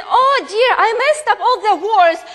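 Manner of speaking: high, loud flustered cry, rushing at the end; caught-out oops, gabbled fast; dismayed blurt. Retold or imitated. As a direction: blurt it out in a laughing panic. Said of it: "And he said".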